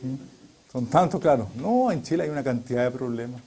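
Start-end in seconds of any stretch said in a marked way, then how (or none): none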